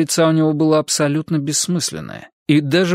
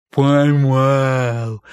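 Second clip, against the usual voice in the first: gleeful mumble. Acted. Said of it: "радостно пробубнил он".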